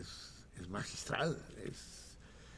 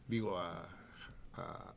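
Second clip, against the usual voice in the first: ordinary talk, nothing marked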